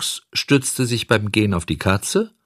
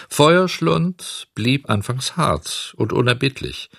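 usual speaking voice

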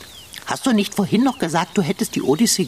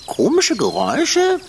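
indignant echo; 7 semitones higher, 4 dB louder than usual. Acted.

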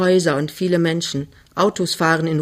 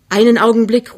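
loud call up the stairwell; 5 semitones higher, 8 dB louder than usual. A narrator's reading. Young man calling up the stairs to his girl, loud. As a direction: call her back before she's gone. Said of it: "ruft er".

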